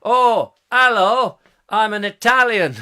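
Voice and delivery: loud, high mock-Italian; Italian accent. Shown as a demonstration. Introducing himself as an Italian, loud Italian accent. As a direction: greet in a hammy Italian accent. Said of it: "I'm gonna do an Italian accent".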